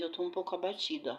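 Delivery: ordinary delivery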